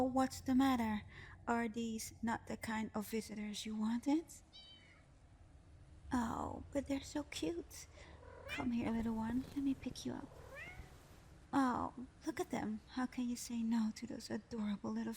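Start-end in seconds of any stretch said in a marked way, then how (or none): none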